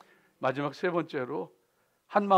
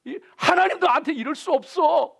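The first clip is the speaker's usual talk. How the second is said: much higher, louder raging complaint; angry outcry; 11 semitones higher, 8 dB louder than usual. Retold or imitated. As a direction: rage at the heavens in disbelief. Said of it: "그 사람이 막 분노할 때". (하나님께 울분을 터뜨리며 높고 크게)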